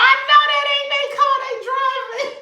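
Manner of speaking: high-pitched, cracking up while retelling; laughing disbelief. Retold or imitated. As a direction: squeal it through laughter. Laughing retold reaction to car.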